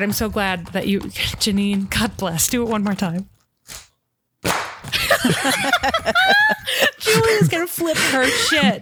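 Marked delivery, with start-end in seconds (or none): 4.4-6.9 shrieking laugh. Real, not acted. Host bursts out laughing.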